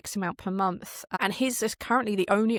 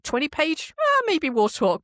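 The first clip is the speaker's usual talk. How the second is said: mock haggling; 8 semitones higher, 5 dB louder, slower than usual. Mock haggling over unpaid work.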